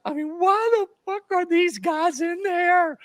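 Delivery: high yelling coach rant; angry coach tearing into an assistant; imitating angry Saban. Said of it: "Saban starts ripping Cignetti's ass".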